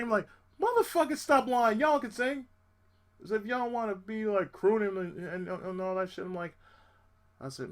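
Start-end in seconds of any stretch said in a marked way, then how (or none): 0.6-2.5 higher, louder rebuke; scolding. Retold or imitated. Telling men who claim they can't sing, scolding. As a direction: call them out.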